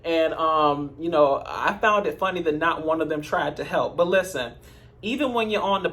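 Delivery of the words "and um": ordinary talk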